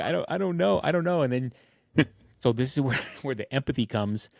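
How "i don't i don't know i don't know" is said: high, whiny retold sob; imitating sobbing. Husband retells sobbing to his wife, mildly imitated. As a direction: squeak it out as if bawling. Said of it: "I'm, like, crying".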